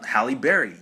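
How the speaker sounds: ordinary delivery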